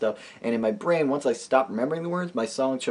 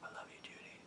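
whisper; 32 dB quieter than usual. Acted. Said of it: "unvoiced aside".

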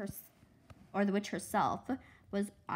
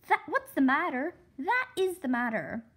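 higher and louder, alarmed; frightened insistence; 7 semitones higher, 6 dB louder than usual. Acted. Scared girl telling her sister about the cat, alarmed. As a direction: point and insist in a panic.

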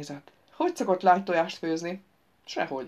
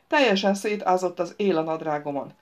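ordinary delivery, nothing marked

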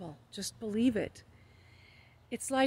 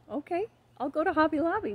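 bright, higher, quick cheerful yes; eager agreement to God's answer, higher and fast; 7 semitones higher, 3 dB louder, faster than usual. Retold or imitated. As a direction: chirp it back eagerly, happy to obey.

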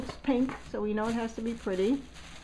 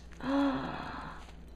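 soft high gasp; delighted gasp; 5 semitones higher, 6 dB quieter than usual. Real, not acted. Seeing the dress form she loves, a soft gasp. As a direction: gasp at a favourite thing.

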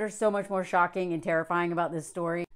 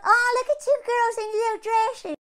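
higher, mock-sweet cooing at the girls; sugary mock voice; 10 semitones higher than usual. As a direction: coo over the little girls in dresses. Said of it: "He's like".

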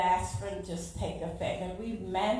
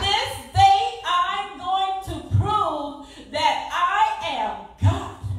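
loud, high, very slow proclamation; preacher voicing God's promise to the congregation; booming divine proclamation; 8 semitones higher, 9 dB louder, much slower than usual. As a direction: thunder out each word like God. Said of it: "He said".

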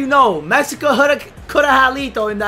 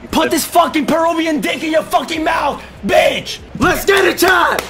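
trash-talk shout; 6 semitones higher than usual. Real, not acted. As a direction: bark the taunt at the rival. Trash talk after goal.

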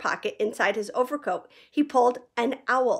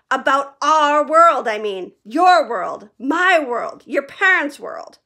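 loud, slow, emphatic; earnest Hagrid; 5 semitones higher, 7 dB louder, slower than usual. A narrator's reading. Hagrid insisting to Harry about their world. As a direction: spell it out slowly.

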